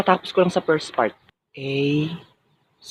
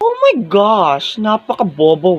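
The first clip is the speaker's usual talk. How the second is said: mocking drawl; slower than usual. Mocking a classmate's failure.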